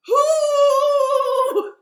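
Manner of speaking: long, very loud high hoot; sustained acted owl screech on "hoo"; frightened owl hoot. Owl hoots in fright at Gruffalo.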